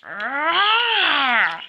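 long yell rising then falling; straining roar. Acted. Engine straining in a fight, long roar. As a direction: roar with a big heave.

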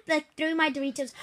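normal talking voice

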